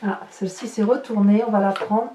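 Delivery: usual speaking voice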